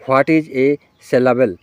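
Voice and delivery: very slow, word by word; deliberate dictating read; slow dictation. Shown as a demonstration. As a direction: spell the question out slowly for students to copy. Dictating exam question to students.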